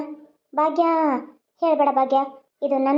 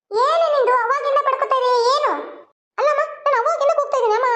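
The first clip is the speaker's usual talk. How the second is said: annoyed complaint; 7 semitones higher than usual. Acted. Daughter calls, mother ignores.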